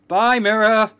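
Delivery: gleeful shout. Real, not acted. Breaking the mirror.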